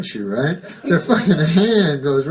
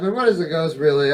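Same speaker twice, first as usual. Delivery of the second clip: ordinary talk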